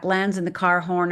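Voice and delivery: ordinary talk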